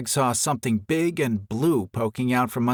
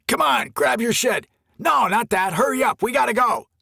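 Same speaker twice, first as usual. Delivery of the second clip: much higher, louder frantic orders; urgent shouting; 12 semitones higher, 3 dB louder than usual. A narrator's reading. Camper yelling at his girlfriend to flee, urgent shout. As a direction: hurry her along, yelling. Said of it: "Greg heard the man shouting".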